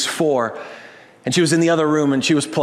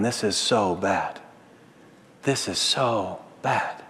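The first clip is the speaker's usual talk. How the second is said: lower, softer, slow mock moan; low, self-mocking complaint; 6 semitones lower, 6 dB quieter, much slower than usual. Preacher mocking his own complaining to the congregation, low and slow. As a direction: mutter it like a grown-up feeling sorry for himself. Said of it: "roll around on the ground and complain".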